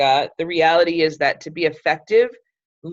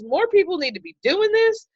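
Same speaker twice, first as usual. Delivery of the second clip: friend's voice; 13 semitones higher than usual. Retold or imitated. Friend's quote retold.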